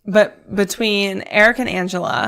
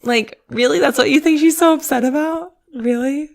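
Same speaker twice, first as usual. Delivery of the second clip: high-pitched, disbelieving questioning; mock incredulity; 7 semitones higher, 3 dB louder than usual. Mocks a clueless assumption.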